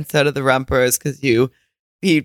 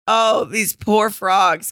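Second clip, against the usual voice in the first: drawn-out, higher mock pity; slow pitying sigh; 7 semitones higher, much slower than usual. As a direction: stretch it out, pitying the frogs. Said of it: "I was like".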